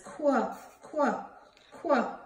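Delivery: "Quah" as a short kw burst; letter sound demo. Shown as a demonstration. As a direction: sound out "Quah" as kwuh, falling each time. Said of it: "Queenie Quail says".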